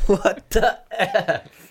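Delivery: put-on high, very slow echo of a shocked kid; drawn-out high mock disbelief; imitated kid outburst. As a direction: mimic the seven-year-old, stretching every word. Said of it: "very loudly and goes".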